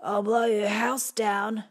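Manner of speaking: slow, resigned wolf threat; a weary, defeated wolf; tired wolf voice. Acted. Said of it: "Resignation and fatigue crept into Wolf's voice".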